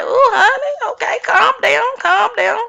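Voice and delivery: high, exaggerated soothing voice; mock soothing. Vlogger mock-calming crying, cussing housewives, high sing-song coo. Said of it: "I'm like".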